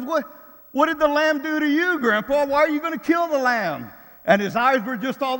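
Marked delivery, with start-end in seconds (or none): 0.0-3.9 put-on alarmed child. Grandson upset about the Lamb.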